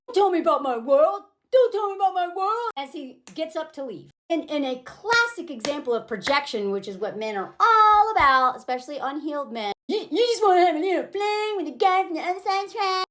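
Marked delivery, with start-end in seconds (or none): 0.1-2.7 put-on voice of an indignant man, rising on the repeat; mock defensive boyfriend. Man challenged by girlfriend, mocking imitation of his defensiveness. Voices the man's angry comeback. 9.9-13.1 higher, slurred put-on voice of an accusing man; mocking imitation. Insecure man accusing her of a fling, mock whining. Mimics man's accusation, projection.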